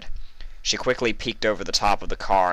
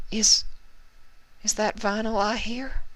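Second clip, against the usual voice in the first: weak injured pony. A narrator's reading. Injured Ruby voiced.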